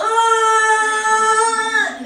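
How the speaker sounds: a short sung-out groan cutting in; labor groan. Retold or imitated. Sister in labor cuts in with a sung groan. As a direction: let a pained groan slip in.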